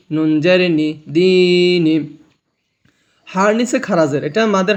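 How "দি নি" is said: slow drawn recitation. Shown as a demonstration. Teacher slowly models দি নি for students. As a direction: stretch দি নি slowly. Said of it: "এক আলিফ টানিয়ে পড়তে হবে".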